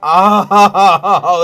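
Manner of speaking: huge loud sneeze; big sneeze. Real, not acted. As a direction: let out a deafening dad sneeze. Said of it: "I have dad sneezes".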